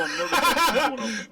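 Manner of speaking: loud, drawn-out wobbling voice; juddering nervous cry. Retold or imitated. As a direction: stretch it out as if being jolted. Mimics being jolted while confessing.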